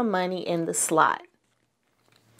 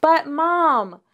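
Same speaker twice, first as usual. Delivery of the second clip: high whining little bear; whiny cub; 7 semitones higher than usual. A narrator's reading. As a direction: whine it like a small cub. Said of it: "whined Sister".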